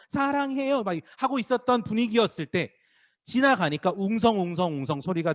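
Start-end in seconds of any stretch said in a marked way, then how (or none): 0.1-0.8 high-pitched mock fan cheer; high, drawn-out fan call; adoring fan. Acting out fans cheering their idol.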